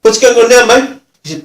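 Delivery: high, loud irritated complaint; mock-aggrieved, pushy protest; annoyed offender. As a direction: imitate a rough bloke getting fed up.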